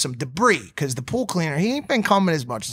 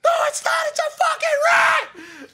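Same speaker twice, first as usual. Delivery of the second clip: high, loud, panicked shriek; imitated panicked girlfriend; 3 dB louder than usual. Girlfriend insisting the leaf is a rat, high panicked mimic. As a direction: mimic her certain it's a rat. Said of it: "and she goes".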